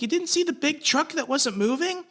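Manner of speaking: replaying his own angry disbelief; retold yelling. To the couple who hit him, a high incredulous yell. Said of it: "I'm yelling at these people".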